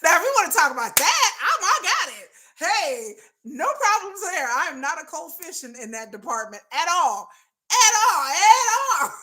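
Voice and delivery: high, loud laughing boast; giggly excitement. Real, not acted. Telling viewers intimacy is her realm, laughing loudly. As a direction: laugh and brag at once.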